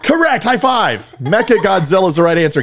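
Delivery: laughing, high and loud praise; delighted. Real, not acted. Dad cheering his daughter's right answer, delighted and laughing.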